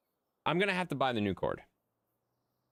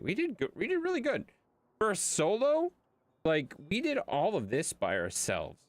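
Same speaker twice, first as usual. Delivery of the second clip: excited; 9 semitones higher than usual. Real, not acted. Proud of solo work.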